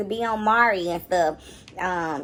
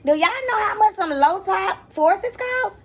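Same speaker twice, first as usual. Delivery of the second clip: outraged; 9 semitones higher, 3 dB louder, slower than usual. Real, not acted. Outraged at shoe prices.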